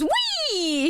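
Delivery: high, drawn-out gleeful squeal; playful high "whee"; excited cat. Retold or imitated. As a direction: voice a cat launching into a chase. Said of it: "he goes".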